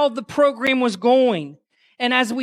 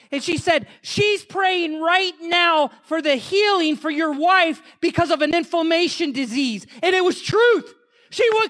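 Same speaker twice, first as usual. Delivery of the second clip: higher-pitched, fervent preacher's storytelling; impassioned testimony preaching; 6 semitones higher than usual. Real, not acted. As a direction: preach the miracle with rising fervor.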